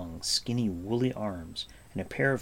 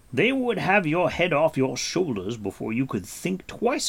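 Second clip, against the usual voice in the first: raised, stern warning; Gwydion's voice; 6 semitones higher, 5 dB louder than usual. A narrator's reading. Gwydion warning Gurgi, raised and stern. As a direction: warn him bluntly.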